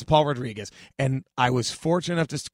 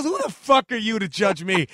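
mock-indignant challenge, pitched up; higher-pitched, mock outrage; retold crowd-berating; 5 semitones higher, 4 dB louder than usual. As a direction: imitate a comic turning on the audience.

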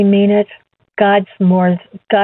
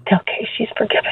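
hushed whisper; 3 semitones higher, 7 dB quieter, faster than usual. Retold or imitated. Dying pastor telling Kay she's forgiven, whispered. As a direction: whisper it softly and quickly, almost breathless.

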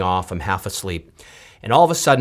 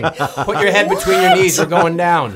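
higher, laughing mock panic; mock panicked passenger; 6 semitones higher, 3 dB louder than usual. Imagined passenger panics at a dude pilot, higher and laughing. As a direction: ham up a passenger losing it. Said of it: "You'd be like".

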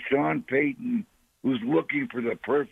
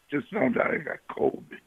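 normal talking voice

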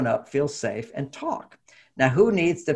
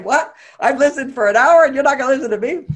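high, loud, outraged protest; indignant outburst; 10 semitones higher, 6 dB louder than usual. Retold or imitated. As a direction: protest like you've been cheated.

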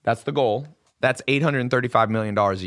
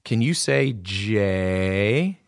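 put-on very slow, each word drawn out for a small child; slow teaching voice; 4 semitones lower, much slower than usual. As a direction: spell it out slowly like coaching a kid. Big brother teaching slow enunciation.

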